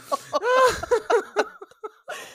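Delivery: high helpless laughter; hysterical laugh. Real, not acted. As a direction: laugh until you can't breathe. Helpless laughter after witch remark.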